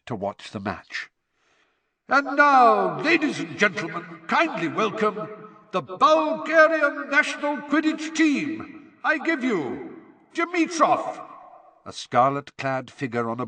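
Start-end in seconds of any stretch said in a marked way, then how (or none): 2.1-11.2 high, ringing showman's introduction; stadium announcer. Acted. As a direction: present the team to a packed stadium.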